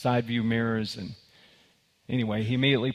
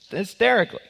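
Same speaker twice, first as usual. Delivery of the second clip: high, loud burst of hysterical laughter; 11 semitones higher, 5 dB louder, faster than usual. Retold or imitated. Preacher tells the cowboy joke, mimicking the little guy's hysterical laugh. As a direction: cackle it out like the little guy on the roadside. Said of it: "the little guy's just over there laughing".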